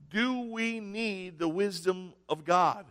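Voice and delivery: ordinary talk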